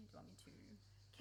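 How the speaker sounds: hushed, breathless whispered question; a soft whisper to a best friend. Acted. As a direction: barely dare ask it aloud. Tender confession, whispered question.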